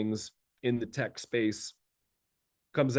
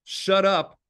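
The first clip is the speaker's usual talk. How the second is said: mock blunt shout; 8 semitones higher, 6 dB louder, slower than usual. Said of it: "all he did was say".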